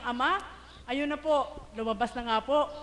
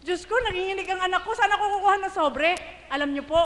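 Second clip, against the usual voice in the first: usual speaking voice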